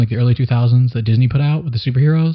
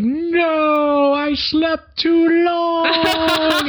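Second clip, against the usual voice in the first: much higher, very slow anguished wail; mock despair; much slower than usual. Ash waking up too late in a wasteland, hammed-up high anguished wail. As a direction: wail it like a ruined hero.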